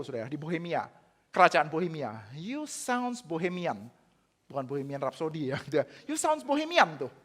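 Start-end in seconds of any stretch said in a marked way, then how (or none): 2.3-3.9 drawn-out, slow English taunt; slow mocking accusation. John Eck slowly taunting Luther in the debate. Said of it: "John Eck bilang gini". 6.1-7.0 much higher, sing-song English taunt; high mocking accusation. John Eck teasing Luther, pitched high and mocking. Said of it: "John Eck bilang gini".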